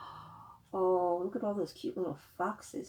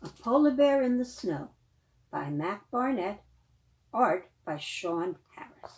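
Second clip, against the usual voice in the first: bright storybook read; reading to children; 3 semitones higher than usual. A narrator's reading. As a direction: read the title to the kids. Reading picture book aloud.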